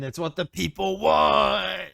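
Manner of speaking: high, drawn-out, loud mock plea; playful pleading shout. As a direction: egg them on with a slow, hammed-up holler. Mock plea to get her performing.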